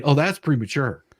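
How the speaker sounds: ordinary talk, nothing marked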